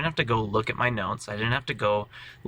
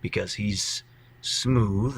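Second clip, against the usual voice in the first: smooth voice, pitched down and slow; down low, smooth; 2 semitones lower, slower than usual. Shown as a demonstration. Player shows where the smooth character's voice sits, low and slow. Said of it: "his voice is down here".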